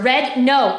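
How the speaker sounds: high, loud objection; alarmed protest. A narrator's reading. Woman protesting her sister's sacrifice, alarmed. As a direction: cry out to stop them.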